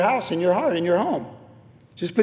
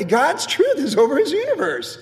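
ordinary talk, nothing marked

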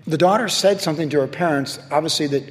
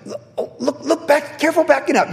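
much higher fussing wife voice; Jennifer nagging; 11 semitones higher than usual. Retold or imitated. Wife warning her husband while he reverses, fussing mock voice. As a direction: mimic a worried wife warning him, pitched up. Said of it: "Jennifer's always like".

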